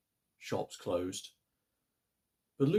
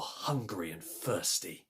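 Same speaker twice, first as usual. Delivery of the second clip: usual speaking voice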